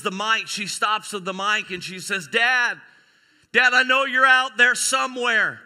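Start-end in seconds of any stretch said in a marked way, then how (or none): 2.3-5.6 loud, high call across a crowd; hammed-up calling out. Graduate calls to dad from the mic, mock-earnest shout. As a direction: project it to dad at the back.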